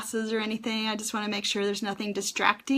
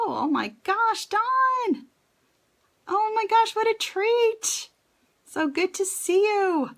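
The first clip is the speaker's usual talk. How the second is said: much higher, delighted squeal of welcome; thrilled greeting; 11 semitones higher than usual. Real, not acted. Thrilled to see old friend.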